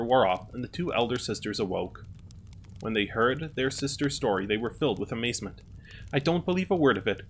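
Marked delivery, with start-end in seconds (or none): 6.1-7.3 higher, mildly raised disbelieving exclaim; cried out. A narrator's reading. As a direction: toss it back in mild disbelief. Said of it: "cried the oldest".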